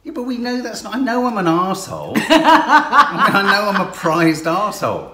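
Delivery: loud, laughing confession. Real, not acted. Laughing self-mockery.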